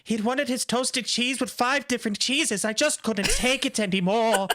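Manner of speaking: quick, high hammed-up line; mock villain. Joking about killing the butler, hammed-up villain. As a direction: toss it off like a gleeful killer. Continues mock murderer excuse.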